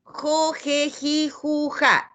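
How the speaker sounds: "jo, je, ji, ju, ja" each syllable spaced out; syllable drill. Shown as a demonstration. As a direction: drill "jo, je, ji, ju, ja" for children. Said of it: "aprenden otras, como". (recitando "jo, je, ji, ju, ja")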